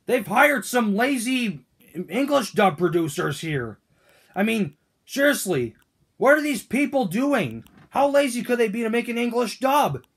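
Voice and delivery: usual speaking voice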